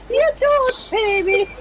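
high, slow squeaky mimicry; mocking imitation. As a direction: mimic a friend in a stretched squeal. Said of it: "you sound like".

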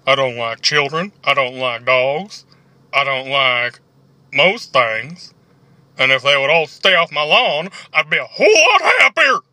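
put-on grouse about kids and the lawn; grumpy old man. Acting a grumpy neighbour character.

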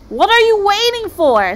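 high, loud, drawn-out question; impatient demand. Acted. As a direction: bellow it, eager to get going.

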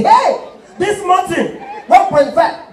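very high, loud mock exclamation, slow; laughing host shrieks at the sight; high shocked host. Host shocked at big man.

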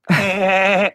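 high, nasal-sounding cartoon chuckle. Cartoon dad capping his own joke, Peter Griffin laugh. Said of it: "as Peter Griffin".

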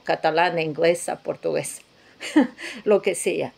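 ordinary talk, nothing marked

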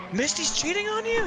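high-pitched mock disbelief; retold shock. Friend just heard his wife cheated, high stunned disbelief. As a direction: squeak it out, stunned at the news. Retelling reaction to friend's news.

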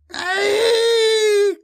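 piercing high keening scream; long, loud, very high shriek; keening cry. A narrator's reading. Voice cries out under Jomi's psychic attack, keening scream. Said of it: "cried the voice, keening".

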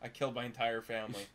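low, soft, offhand imitation of a killer; nonchalant confession. Viewer imitating the murderer confessing in bed, nonchalant. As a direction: play the confession as casually unbothered. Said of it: "nonchalantly sits in that bed and he just says".

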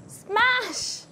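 excited. Real, not acted. Smashing a coconut in game.

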